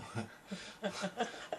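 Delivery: a burst of genuine high laughter; high giggling laugh that escapes mid-sentence; real laugh. Real, not acted. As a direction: break into a real laugh at your own reading. Reader cracks up mid-passage.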